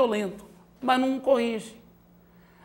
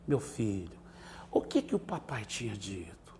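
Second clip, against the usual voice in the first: much softer and lower, tender question; gentle, loving; 10 semitones lower, 12 dB quieter than usual. Shown as a demonstration. Father gently reminding son of the rule, tender. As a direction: ask with love, no yelling. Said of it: "sem gritaria, com amor, com carinho".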